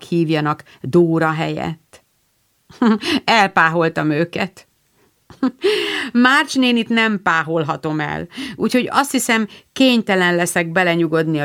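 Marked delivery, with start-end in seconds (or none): 5.4-6.1 a quick bright laugh, high; amused little laugh; short laugh. Acted. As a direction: laugh it off lightly. Lori laughs at Joe's remark.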